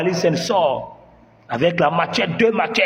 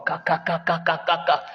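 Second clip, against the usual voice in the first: ripping sound; 4 dB quieter than usual. Acted. Ripping open the collar.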